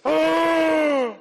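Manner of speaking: loud, high mock gasp; hammed-up horrified gasp; shocked gasp. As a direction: gasp as if scandalized.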